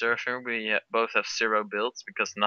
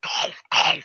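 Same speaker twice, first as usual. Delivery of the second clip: whispered catchphrase; 5 dB louder than usual. Pretend. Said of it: "Say "Gom" once".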